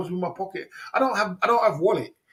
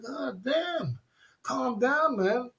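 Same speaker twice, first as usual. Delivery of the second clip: exasperated; 8 semitones higher, 4 dB quieter, much slower than usual. Retold or imitated. Telling the girl to calm down.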